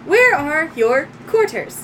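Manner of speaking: high, loud robot helper; little droid. Acted. Little droid offering help to owner, high robotic voice. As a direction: offer service in a small robot's voice. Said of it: "And it goes".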